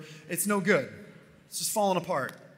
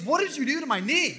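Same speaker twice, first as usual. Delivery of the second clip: high, loud, amazed question; astonished; 10 semitones higher, 8 dB louder than usual. Retold or imitated. Healed man asks the preacher, astonished and loud. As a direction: blurt it out in shock.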